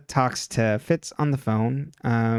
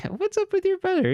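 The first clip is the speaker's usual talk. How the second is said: high gossipy friend. Retold or imitated. Friends gossip about Jason.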